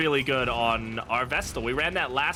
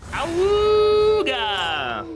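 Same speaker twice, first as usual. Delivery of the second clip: loud high stretched awooga; ahooga horn; 7 dB louder, much slower than usual. Pretend. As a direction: howl it like an old car horn. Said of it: "What if my neighbors ever hear me do that?".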